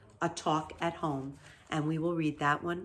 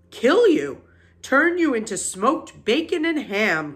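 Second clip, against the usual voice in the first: loud, higher, emphatic sheep voice; old sheep's grim warning; 6 semitones higher, 7 dB louder than usual. A narrator's reading. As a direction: hammer home the bad news loudly. Old sheep spells out grim news.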